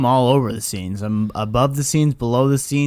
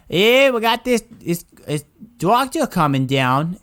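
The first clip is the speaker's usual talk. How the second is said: higher-pitched imitation of a Boston accent; thick Boston accent; 6 semitones higher than usual. Boston lady on a work phone call, imitated thick Boston accent. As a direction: put on a broad Boston accent, mimicking a caller. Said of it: "she just has the thickest accent ever".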